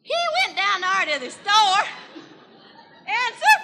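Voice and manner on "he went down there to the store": ordinary talk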